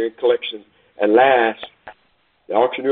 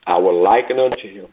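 earnest preaching; 5 semitones lower than usual. Acted. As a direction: bring it home gently to the congregation. Sermon application.

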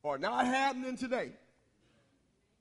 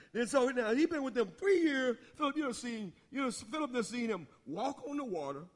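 normal talking voice

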